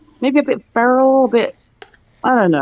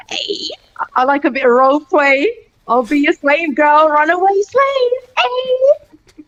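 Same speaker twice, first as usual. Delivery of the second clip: much higher, teasing come-on; 6 semitones higher, slower than usual. Pretend. Joking about KKK rally, hammed-up flirty voice. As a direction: ham up a flirty offer. Mock role play offer.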